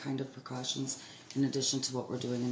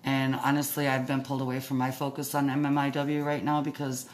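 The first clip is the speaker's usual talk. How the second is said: muffled through a face mask; talking through a mask. Real, not acted. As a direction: speak muffled behind a mask. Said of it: "It's probably hard to understand me".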